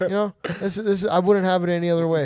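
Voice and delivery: high, loud sarcastic cheer; hammed-up sarcastic approval; mock delight. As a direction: gush with put-on contentment, near laughing. Continues sarcastic delight, laughing.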